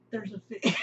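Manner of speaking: punchline collapsing into high laughter; cracking up. Real, not acted. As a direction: burst out laughing on the last word. Cracks up at imagined sign.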